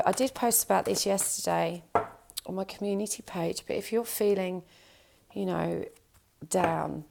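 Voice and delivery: normal talking voice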